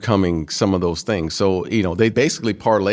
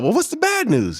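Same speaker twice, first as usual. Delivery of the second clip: high-pitched, acted-out asking; high retold question at normal volume; retold eager question. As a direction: pitch it way up, imitating your excited younger self. Retold young self, mid-celebration.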